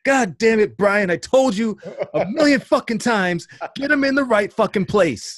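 exasperated boss yelling at worker; angry boss voice. Acted. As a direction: chew out a careless underling. Acting angry alien boss.

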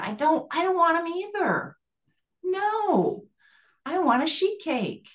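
put-on high, sulky childish whine; whiny inner voice. Mocking her own old thinking, high childish whine. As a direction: pout like a kid who wants cake instead. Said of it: "my best thinking".

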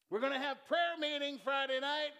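shouted high and loud at the crowd; loud announcement. Acted. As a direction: boom it out like a challenge. Preacher tests the crowd.

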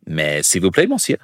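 a playful put-on French accent to egg the guest on; mock French accent. Host teasing his guest in a mock French accent.

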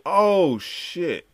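sudden loud oh; startled outburst; surprised. Real, not acted. Reacting to fight clip.

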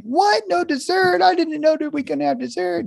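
put-on very high, whiny child's voice; whining kids. Parent mimics children crying over no dessert, high whiny. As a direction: mimic a wailing kid who lost dessert.

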